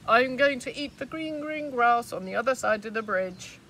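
defiant big goat; bold big goat. Acted. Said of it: "said the big billy goat Gruff".

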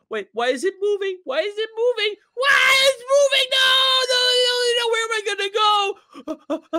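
frantic, high-pitched panicked shouting; rising panic. Acted. Player seeing a statue start moving, building panic. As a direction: start alarmed and build to a high, loud cry.

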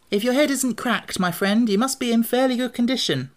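put-on high-pitched storybook boy; Tip, reassuring. A narrator's reading. Boy reassuring his pumpkin-headed friend, high and lively.

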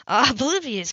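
ordinary delivery

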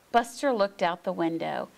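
ordinary delivery, nothing marked

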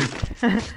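short real chuckle; real amused laughing. Real, not acted. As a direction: laugh at your own joke. Laughs at own teasing nickname joke.

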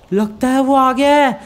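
loud, high burst of excitement; thrilled realisation. Acted. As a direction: raise pitch and volume as hope takes over. Repeats it, thrilled at the arrival.

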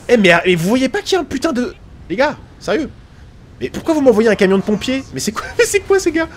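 high-pitched, incredulous protest; exasperated. Real, not acted. Player to colleagues who sent a fire truck, exasperated. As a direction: protest with mounting disbelief, voice climbing.

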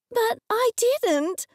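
high, drawn-out screech of protest. A narrator's reading. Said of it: "I screeched".

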